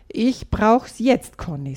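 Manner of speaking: put-on boy telling his sister firmly and slowly. A narrator's reading. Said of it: "sagt Tom bestimmt".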